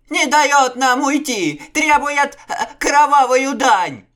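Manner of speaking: very high, loud, slow terrified squeal; shrieking. A narrator's reading. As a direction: keep squealing in dread. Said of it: "взвизгнул Акыс".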